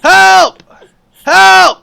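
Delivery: loud high yell; being tickled. Real, not acted. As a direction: cry for help, squirming.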